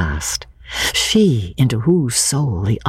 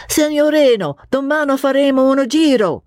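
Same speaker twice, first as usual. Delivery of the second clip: high, bright Italian cabman hailing a fare; cabman's Italian call; 13 semitones higher than usual. Acted. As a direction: call it out like an Italian cab driver, sure of the sale. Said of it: "called the cabman with engaging certainty".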